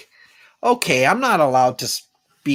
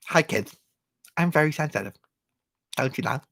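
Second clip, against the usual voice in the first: puppet voice; 7 dB quieter, faster than usual. Acted. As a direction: talk like a kids' puppet. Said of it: "That's my puppet face".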